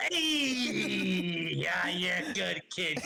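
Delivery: high, drawn-out greeting holler; high, hearty mascot send-off; drunk mascot's cheer. Acted. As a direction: echo the call high and long, sliding down. Mascot calls back, echoing player.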